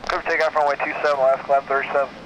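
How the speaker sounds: ordinary talk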